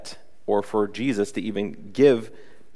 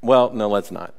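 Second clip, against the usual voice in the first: ordinary delivery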